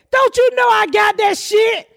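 high, slow shouted line; mock shout. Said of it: "He said".

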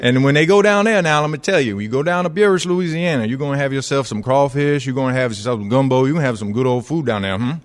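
rolling, folksy Louisiana talk; Louisiana accent. Acted. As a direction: ham up a Southern Louisiana local. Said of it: "that's how Brian Kelly is going to talk".

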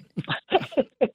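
laugh. Real, not acted. Laughs at his own bit.

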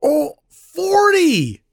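slow, high, stretched-out disbelief; can't believe the number; real amazement, drawn out; astonished. Real, not acted. Reacts to forty viewings.

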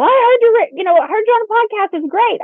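high, eager fan gushing; excited fan. Retold or imitated. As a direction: play the thrilled listener who spotted you. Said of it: "have someone in the community walk up to me and go".

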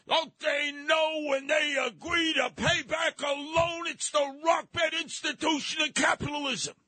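put-on high, slow, shouting old codger; old prospector. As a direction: rant like a cranky old prospector. Mocking righteous caller rant.

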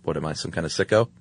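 a guilty cook muttering under his breath; mock quiet conscience-stricken cook; cook's aside. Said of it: "and he's like".

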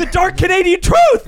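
high, loud hammed-up reveal; over-the-top doom announcement; mock-dramatic proclamation. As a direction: proclaim it like a terrible secret. Hamming up a shocking revelation.

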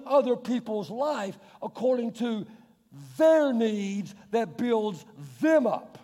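loud, slow, driving sermon voice; forceful preaching. Real, not acted. Pastor pressing the point to his church, forceful preaching. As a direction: hammer home each phrase.

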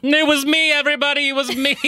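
excited. Real, not acted. Claims gift, playful boast.

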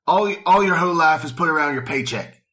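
loud, high cult-leader rant; Charlie Manson impression. Podcaster quoting Manson on paychecks and money, loud ranting impression. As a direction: belt it out high and forceful, like an interview outburst. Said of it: "quite a good Charlie, Charlie Manson impression".

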